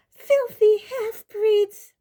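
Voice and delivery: high, loud, drawn-out shriek; screamed insult. A narrator's reading. Umbridge screaming at centaurs, high furious shriek. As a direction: scream it in outraged panic. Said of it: "she screamed".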